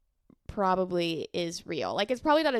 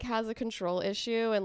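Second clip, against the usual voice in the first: ordinary talk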